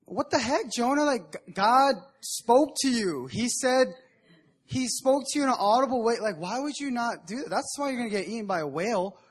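put-on a kid scolding Jonah in disbelief; exasperated kid. Young listener scolding Jonah for not obeying, exasperated. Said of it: "I was like".

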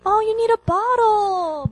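put-on high, slow mom baby-talk; sing-song, high-pitched mom imitation. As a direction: coo it like a mother to her baby. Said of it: "mom just takes the baby back and says".

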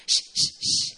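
put-on soothing baby shush. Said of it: "sometimes I shush her".

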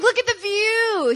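high, slow imitation of amazement; gushing delight. Husband pointing out the view to wife, gushing. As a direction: draw it out in mock wonder.

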